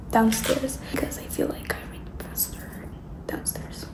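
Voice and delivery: whispered plan; hushed whisper. Real, not acted. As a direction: whisper it quietly. Whispering about reading downstairs.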